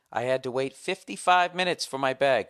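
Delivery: "fifty-five" punched out loudly; stress on fifty-five. Acted. Customer reading his bag complaint, "fifty-five" stressed in capitals. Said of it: "Fifty-five is capitalized, as to stress".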